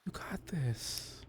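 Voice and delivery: Luca soothing a nervous colleague, soft and unhurried; reassuring Luca. Acted. Luca comforts the nervous professor.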